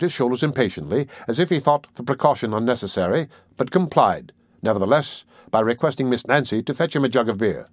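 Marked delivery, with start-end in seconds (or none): none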